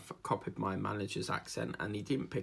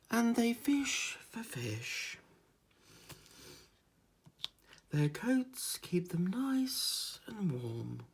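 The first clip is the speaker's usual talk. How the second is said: very slow, higher mock nature presenter; documentary narrator; 9 semitones higher, much slower than usual. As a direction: drawl it like a cosy wildlife film. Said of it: "the one there he goes".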